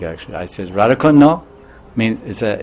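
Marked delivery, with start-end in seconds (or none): 0.7-1.4 "Radhakund, no?" with "no" lifted high as a question; Indian questioning intonation. Retold or imitated. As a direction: turn "no" into a rising Indian-style tag question. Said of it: "in Indian way, it's the way Indians speak".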